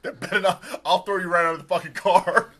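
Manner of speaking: loud, high threat breaking into laughter; mock angry dad. Dad threatening son in car, laughing mock rage. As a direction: threaten loudly, laughing between phrases. Repeats father scolding son.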